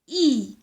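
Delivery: "I" held as a long ee; isolated I sound. Shown as a demonstration. Teacher showing what the letter writes, isolated I sound. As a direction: sound out "I" alone, firmly drawn out. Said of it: "sert à écrire le son".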